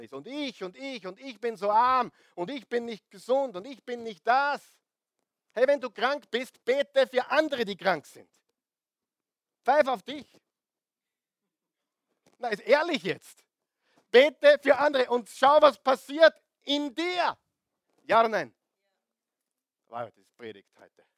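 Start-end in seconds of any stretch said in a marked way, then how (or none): 0.1-4.6 mock whiny lament; a hint of mock whining; mock self-pity. Mocking self-pitying churchgoers. 5.5-18.5 high, loud preaching exhortation; rousing call from the pulpit; fervent preaching. Acted. Preaching at full force.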